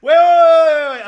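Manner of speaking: very loud, high laughing plea to stop; laughing outburst. Real, not acted. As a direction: shout it through laughter, stretched out. Laughing, halting chat to search.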